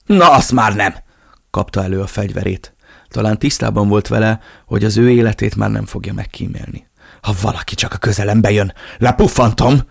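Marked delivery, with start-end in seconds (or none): 0.0-1.0 loud, higher defiant outburst; defiant shout. Acted. Cornered man draws his gun. 7.2-9.8 loud, menacing threat; armed threat. Acted. Armed man threatens everyone.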